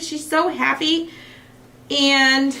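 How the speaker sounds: usual speaking voice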